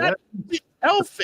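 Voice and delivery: mock voice. Mocking voice, high far.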